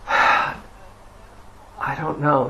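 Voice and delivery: slow, sighing helplessness; exasperated. Retold or imitated. Student to his meditation teacher, sighing exasperation. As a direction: sigh first, then give up slowly.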